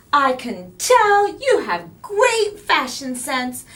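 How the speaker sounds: loud, slow, bubbly with a laugh; giggly bright compliment; brightly. A narrator's reading. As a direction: flatter him cheerfully. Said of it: "Carla continued brightly".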